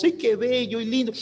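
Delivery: high cooing visitor's voice; baby talk. Retold or imitated. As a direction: gush over the newborn. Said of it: "diferente tono de voz".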